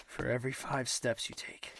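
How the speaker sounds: normal talking voice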